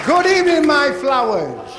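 booming high welcome, slowing on my flowers; loud greeting. Real, not acted. Comic greeting the audience, booming and high. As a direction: greet the crowd big and hammy.

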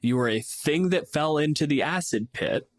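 normal talking voice

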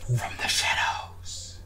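slow breathy whisper. Pretend. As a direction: hush it slowly, like a sneaky assassin. Sneaky dramatic game line.

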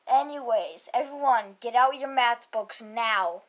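usual speaking voice

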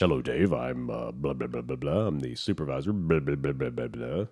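low, quiet, droning supervisor; bored supervisor voice. Retold or imitated. Host mocking the bank supervisor, low bored drone.

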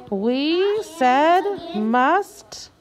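very slow, words drawn out; slow reading. Shown as a demonstration. Teacher slowly reading the highlighted words aloud. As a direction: stretch each word for the class. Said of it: "slow word modeling".

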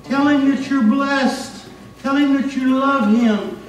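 loud, high, emphatic preaching; impassioned preaching. Real, not acted. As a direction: drive it home to the congregation at full force. Preacher exhorting congregation to thankfulness.

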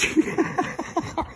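breathless high-pitched giggle. Real, not acted. Laughing at goofy horse.

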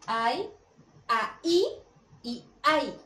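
three homophones said with different tunes; contrasting intonation. Shown as a demonstration. Teacher contrasting hay, ahí and ay for the class. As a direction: show how each one sounds different. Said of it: "Como en la entonación".

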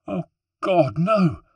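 high, loud wail of disbelief; anguished cry. Acted. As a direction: cry out at terrible news. Sister learns of a death.

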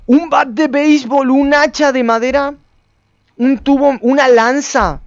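high, drawn-out excitement; amazed discovery. Real, not acted. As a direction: list the finds slowly in high-pitched amazement. Descubre armas. (asombro emocionado, voz aguda)

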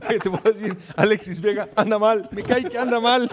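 laughing mock sob, pretending to cry. Pundit fakes tears over Alexis Vega, laughing. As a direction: hams up fake tears through laughter. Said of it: "ya voy a llorar".

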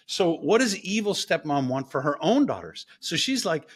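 ordinary talk

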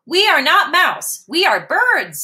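high chirpy bird chorus. Acted. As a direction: chirp it like little birds. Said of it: "say the birds".

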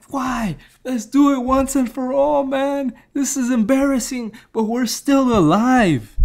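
imitating an old boxer begging for the fight; pleading rival.

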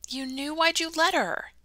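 high, rising disbelief; shocked accusation. Acted. Ella confronting Mandy about her mother, high and shocked. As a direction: demand it in hurt surprise.

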